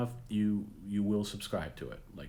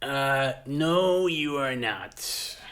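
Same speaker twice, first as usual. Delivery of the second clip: high, loud, stretched-out denial; mock busting; 7 semitones higher, 8 dB louder, much slower than usual. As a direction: flatly refuse the prank caller in a hammed-up high voice. Host rejecting the fake English caller.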